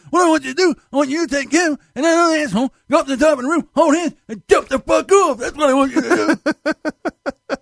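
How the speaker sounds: high, strained angry boss, slowing at the end; Herb. Retold or imitated. Boss tells mechanic where to go, high furious impression. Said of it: "I haven't done that voice in a long, long time".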